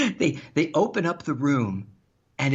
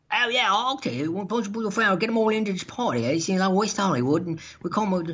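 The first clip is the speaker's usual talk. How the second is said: higher-pitched British-Australian caricature; British or Australian accent; 6 semitones higher than usual. Retold or imitated. Foot fetish guy invites the host's friends, British-Australian accent. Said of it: "a British guy or Australian guy".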